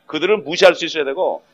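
ordinary talk, nothing marked

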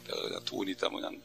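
whispered, dropped confession; whispered aside. Real, not acted. Preacher confiding he would give money if he had it. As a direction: breathe it as a private wish.

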